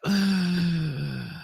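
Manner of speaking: long, voiced exhale; long sigh. Real, not acted. Streamer puzzled by own silly voice, long sigh. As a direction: let out a drawn-out sigh.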